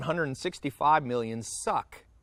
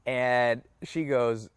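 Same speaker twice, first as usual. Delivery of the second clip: normal talking voice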